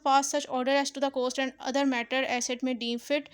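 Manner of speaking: ordinary delivery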